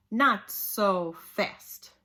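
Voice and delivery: new witchy teacher halting the class; hiss. Acted. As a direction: stop them cold, slowly. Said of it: "hissed an unpleasant voice".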